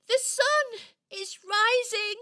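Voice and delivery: high, loud cry of dismay; alarmed. Acted. Toy seeing the sun rise too soon, alarmed. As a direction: call it out in horror. Toy realises time has run out.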